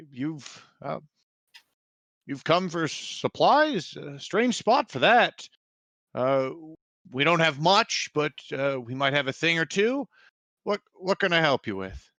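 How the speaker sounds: put-on a wary old villager; village quartermaster.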